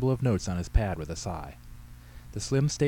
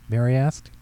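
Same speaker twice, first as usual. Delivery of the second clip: plain reading voice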